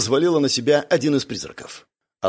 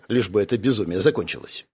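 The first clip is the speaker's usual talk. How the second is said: ordinary delivery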